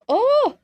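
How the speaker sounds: loud, high yawning oh; big yawn sound. Real, not acted. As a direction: let a real yawn burst out. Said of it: "I'm gonna yawn again".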